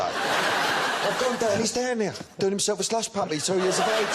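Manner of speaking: high, laughing storytelling; laughing while telling. Real, not acted. Laughing retelling son story.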